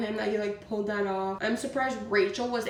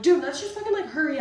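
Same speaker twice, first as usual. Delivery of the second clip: louder, higher exasperated shout; retold yell; 6 semitones higher, 6 dB louder, faster than usual. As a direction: yell at your boyfriend to hurry. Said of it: "I'm like, yelling at him".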